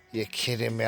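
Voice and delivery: ordinary talk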